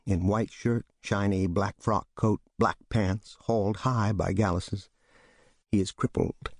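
usual speaking voice

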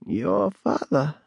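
put-on slow, high, creaky old woman; slow eerie crone voice. A narrator's reading. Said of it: "she repeated".

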